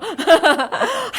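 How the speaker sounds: loud, high burst of real laughter; embarrassed laugh. Real, not acted. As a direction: laugh off sudden shyness. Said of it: "갑자기 부끄러워". (부끄러워 터진 웃음)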